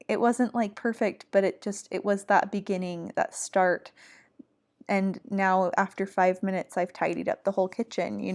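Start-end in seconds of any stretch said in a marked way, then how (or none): none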